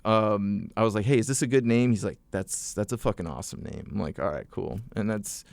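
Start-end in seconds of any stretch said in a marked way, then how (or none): none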